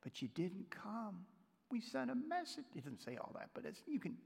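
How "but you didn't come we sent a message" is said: hushed, hurt complaint, rising in pitch; implied reproach. Retold or imitated. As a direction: voice a sister's soft accusation. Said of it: "pick that up in the tone".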